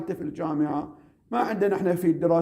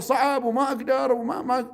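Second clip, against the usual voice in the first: higher pitched mock complaint of a quitter; mock whiny student; 7 semitones higher than usual. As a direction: whine it like a student who gives up. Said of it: "أما يقول واحد". (تقليد طالب يتذمر بصوت أعلى)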